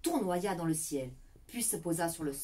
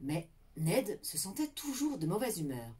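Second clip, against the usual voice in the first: ordinary delivery, nothing marked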